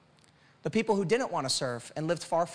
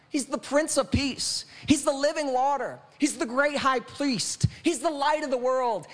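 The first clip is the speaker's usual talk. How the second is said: higher, rhythmic litany, each title proclaimed; full-force preaching; 7 semitones higher than usual. Real, not acted. Preacher answering "who is this Jesus" for the church, full-force preaching.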